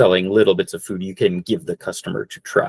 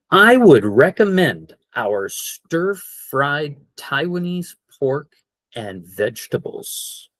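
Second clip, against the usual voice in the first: slow model sentence; 5 semitones higher, slower than usual. Shown as a demonstration. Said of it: "Or more fancy".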